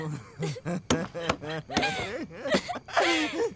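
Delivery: 开心的笑声. Acted. 发誓后笑起来.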